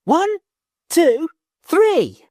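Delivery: put-on high, loud children's yell; high shouted count. Children yelling from church tower.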